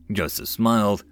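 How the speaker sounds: ordinary delivery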